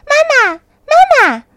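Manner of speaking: put-on high, loud, long-held child's call; hurried calling out. A narrator's reading. Tadpoles swim up to the big frog, calling high, loud and drawn out. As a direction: cry out high and loud, stretching each word. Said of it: "连忙叫着".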